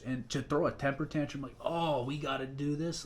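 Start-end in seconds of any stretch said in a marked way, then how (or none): 1.6-3.0 higher-pitched, dragged-out mock whine; whiny, sighing complaint. Soldier mimicking airmen who balk at a chore, mock whine. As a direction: imitate a sulky tantrum, slow and sighing. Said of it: "like, sighing and shit".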